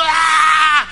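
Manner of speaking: long, high mock frenzy yell; crowd yell. Congregation worked up by pastor, frenzied yell. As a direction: imitate a congregation erupting.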